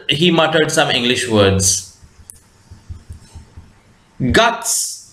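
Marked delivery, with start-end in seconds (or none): none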